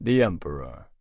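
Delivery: plain reading voice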